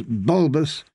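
ordinary delivery, nothing marked

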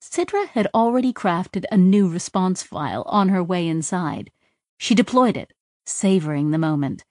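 plain reading voice

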